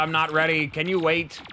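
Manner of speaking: normal talking voice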